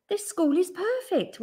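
high, pleased exclamation; grinning delight. A narrator's reading. Wilson delighted with his school, grinning. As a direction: say it through a grin. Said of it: "Wilson grinned".

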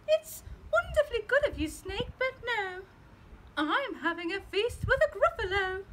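high, bright little mouse voice. Acted. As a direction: answer politely like a clever small mouse. Mouse replies to snake.

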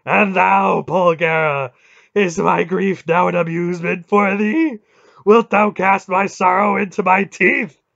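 high, bellowed accusation; thunderous wrathful god; sudden fury. A narrator's reading. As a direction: rage at full volume, outraged and wounded. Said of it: "the god's sudden fury".

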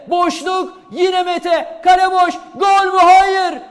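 high, loud near-miss call; screaming football commentator. Real, not acted. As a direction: shout the chance, then the miss. Live chance on goal. (heyecanla bağıran spiker)

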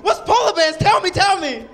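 high, loud eager pleading; eager kid. Retold or imitated. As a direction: beg to be told. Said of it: "I'm like".

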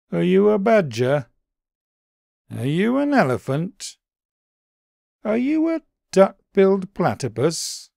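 high, small puzzled bunny voice; a high, wondering little creature; Daily B's voice. A narrator's reading.

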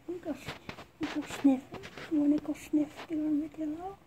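talking around a pencil in the mouth; words blurred by a pencil between the teeth. Real, not acted. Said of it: "That's a top of the pencil in my teeth".

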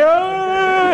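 high mock scream of fleeing people; movie crowd scream. Preacher acting out fleeing crowd, mock scream. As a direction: yelp like a disaster-movie extra. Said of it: "and like".

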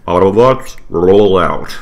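loud booming robot commander; Optimus Prime. Retold or imitated. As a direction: announce it like a giant robot. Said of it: "Optimus Prime".

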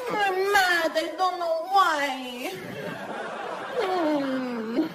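high, drowsy pouting whine; like just woken from a grumpy nap. Retold or imitated. Imagined receptionist, high drowsy grumpy pout. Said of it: "waking up from a grumpy nap".

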